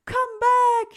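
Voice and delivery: very high, loud shout. Acted. Cyril shouts after the bird, loud. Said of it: "shouted Cyril".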